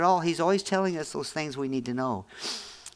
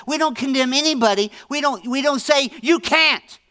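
builds to a higher, louder "You can't"; rising emphatic preaching; 9 semitones higher, 6 dB louder than usual. Real, not acted. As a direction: push the prohibition up sharply to reject it. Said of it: "rising to a louder, higher peak".

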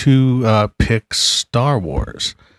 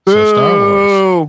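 loud, slow, high "So Star Wars"; emphatic declaration; 10 semitones higher, 5 dB louder, much slower than usual. Real, not acted. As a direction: announce it triumphantly. Defiant vote announcement.

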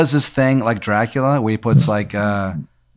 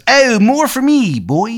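high, drawn-out, bad Maryland accent; loud, badly faked Maryland accent; terrible Maryland accent; 12 semitones higher, 5 dB louder, slower than usual. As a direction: stretch the vowels in a hammed-up Maryland twang. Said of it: "he's got, like, a terrible Maryland accent".

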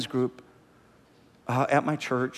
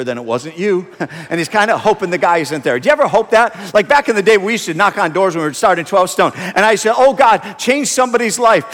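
loud, animated sermon storytelling; full-force preaching; 4 semitones higher, 10 dB louder than usual. Real, not acted. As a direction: tell the story loudly to a big congregation.

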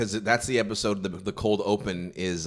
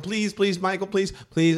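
high, begging imitation; rapid little pleas, pitched up; mock pleading; 8 semitones higher than usual. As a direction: beg like an eager underling. Acts out begging to tell joke.

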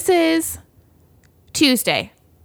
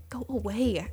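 mock mutter; 12 dB quieter than usual. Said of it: "And I was like".

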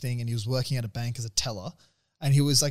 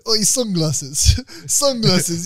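laughing; 6 semitones higher, 6 dB louder, much slower than usual. Real, not acted. Laughing at a fighter's sunglasses.

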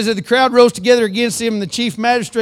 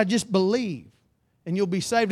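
softly, a touch lower; hushed retold answer; 3 semitones lower, 7 dB quieter than usual. Paul and Silas answer the jailer, quoted softly. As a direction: give the reassurance quietly.

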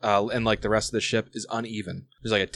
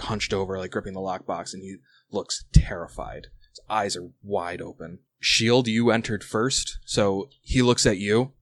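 ordinary talk, nothing marked

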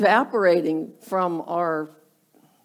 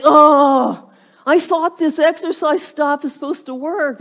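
loud, higher groan of complaint; mock exasperation; 6 semitones higher, 6 dB louder than usual. New exerciser gains two pounds, mock exasperated. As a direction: act out a dieter's indignant letdown.

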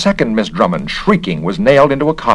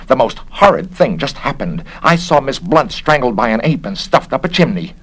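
higher, agitated eyewitness account; horrified report; 6 semitones higher than usual. A narrator's reading. Miss Pope tells of a strangling, horrified. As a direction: report it in mild hysterical horror. Witness reports a murder in horror.

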